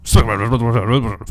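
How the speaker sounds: loud mock ad sign-off, gabbled into a blur; fast disclaimer. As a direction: trail the credit into rapid-fire gibberish. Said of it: "You gotta be really fast".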